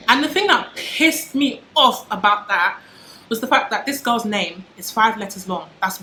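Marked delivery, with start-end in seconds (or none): 0.1-2.8 heated, slow and louder, higher; fired-up indignation, slow and loud; indignant. Real, not acted. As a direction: let the outrage build on each word. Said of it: "I sound so passionate".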